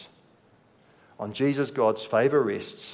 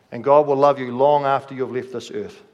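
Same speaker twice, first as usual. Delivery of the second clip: ordinary talk, nothing marked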